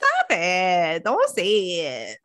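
slow, drawn-out telling-off; mock pleading order. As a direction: stretch out each word to shut him up. Acting out telling him to stop.

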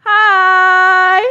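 high, loud sheepish "hi"; awkward hi. Retold or imitated. Orgy guests caught by the roommate's mother, sheepish squeak.

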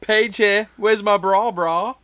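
high loud mock greeting; mock Paige. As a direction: shriek the catchphrase in a silly voice.

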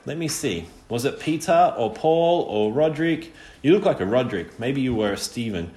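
toying, teasing. A narrator's reading. Jack toys with Bod over his real name, teasing. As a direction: dangle each guess teasingly. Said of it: "He was playing with the boy".

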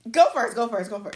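laughing, loud and eager; laughing shout. Real, not acted. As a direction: egg her on through laughter. Urging co-host to answer.

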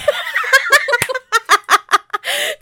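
high burst of laughter; loud laugh. Real, not acted. Laughs at own joke.

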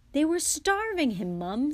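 high, loud plea; protesting. A narrator's reading. George defends the rescue to his mum, protesting. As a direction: protest on a friend's behalf. Son protests to scolding mother.

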